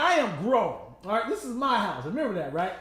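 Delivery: loud, high mock parent scolding; stern parent. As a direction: scold like a parent laying down the law. Mocking a parent lecturing.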